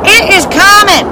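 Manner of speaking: high, loud church preacher cry; preacher promising a coming day; preacher proclaiming. Retold or imitated. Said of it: "they're out there going".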